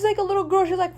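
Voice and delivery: ordinary delivery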